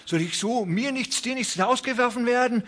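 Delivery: a man begging not to lose his farm; the old farmer pleads over his land; desperate old rancher. A narrator's reading. Ranchers protest their ruin.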